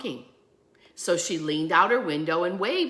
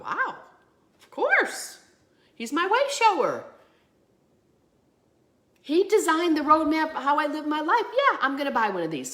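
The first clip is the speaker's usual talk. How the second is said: bright lift, much higher on of course; delighted; 9 semitones higher than usual. Retold or imitated. Shopper seeing the Jesus sticker, delighted and much higher. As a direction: gush with sudden joy.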